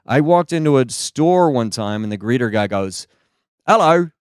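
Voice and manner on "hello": British hello. Retold or imitated. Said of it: "Who the heck says, "Hello"?".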